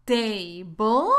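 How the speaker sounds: Table drawn out as TAY-bol, rising at the end; wrong ending. Shown as a demonstration. Teacher showing learners the ending to avoid, questioning mistaken pronunciation.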